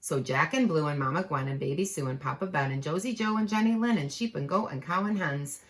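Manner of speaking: ordinary delivery, nothing marked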